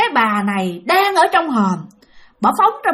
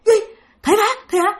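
startled; 8 semitones higher than usual. Retold or imitated. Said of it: "mới hoảng hồn".

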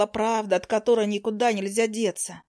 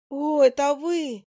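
flustered surprise; 5 semitones higher, slower than usual. A narrator's reading. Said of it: "растерянно сказала она".

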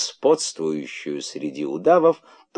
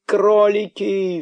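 высокий протяжный зов; зови высоко, растягивая слово; крик издалека; 13 semitones higher, 4 dB louder, much slower than usual. A narrator's reading. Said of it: "всё ещё доносился голос дерзкого кролика". (протяжно кричит, созывая своих)

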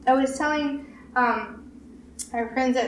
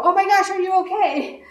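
put-on higher, louder caring cry to a hurt child; concerned parent; 8 semitones higher, 7 dB louder than usual. Parent to child who touched the socket, alarmed and caring. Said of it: "I go".

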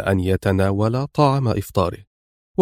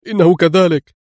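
إنه كذلك repeated much higher and louder, pushing hard; tense insistence; 7 semitones higher, 6 dB louder than usual. A narrator's reading. The henchman repeats to Pancho, much higher and louder. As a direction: insist urgently the second time. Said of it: "أجابه الرجل في توتر".

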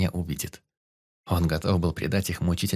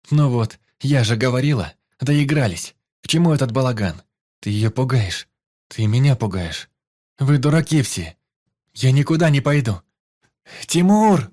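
higher and louder, building to a scream; panicked outburst ending in a yell for Timur; hysterical shouting; 4 semitones higher, 6 dB louder than usual. Acted. Frightened wife breaks into screaming.

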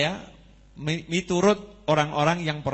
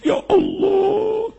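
high, slow, crying prayer of gratitude; tearful thanks; slower than usual. Retold or imitated. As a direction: wail it out as if sobbing in sujud. Grateful man weeping in prostration. (doa syukur sambil menangis, tinggi dan lambat)

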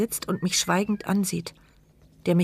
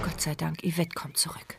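relieved whisper; 3 semitones lower, 9 dB quieter than usual. Acted. Mute narrator's relieved inner thought.

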